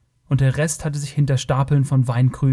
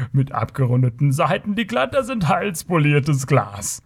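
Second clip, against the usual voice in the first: mocking Kothian rogue voice, rising on the punchline; spöttisch. A narrator's reading. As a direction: ridicule the barbarian with theatrical sarcasm. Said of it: "rief er spöttisch". (höhnisch übertriebener Spott des Kotiers)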